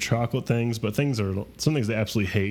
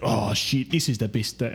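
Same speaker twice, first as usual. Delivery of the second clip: imitated Irish delight; lightly hammed Irish accent; mock Irish accent. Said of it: "That's another Irish accent".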